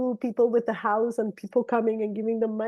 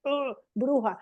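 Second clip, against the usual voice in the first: playful complaining; 2 semitones higher than usual. Retold or imitated. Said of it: "complaining in a, in a good way".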